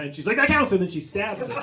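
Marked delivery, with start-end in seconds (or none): 0.4-0.7 quick, loud, high claim of victory; mock triumphant pounce. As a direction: jump in before he can take it back. Ghost pouncing on his cry.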